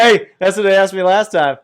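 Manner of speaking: loud, high laughing shout; excited shout. Real, not acted. Friend recalls being heckled, shouting with excitement. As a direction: blurt it out over the laughter.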